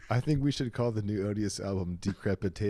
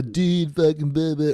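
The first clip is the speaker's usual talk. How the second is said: louder, slow surfer-dude blather; California dude accent; 3 semitones higher, 8 dB louder than usual. Acted. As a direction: mimic a California bro accent. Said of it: "that flat English without the".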